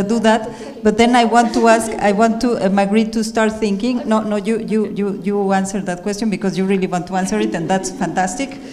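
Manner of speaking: usual speaking voice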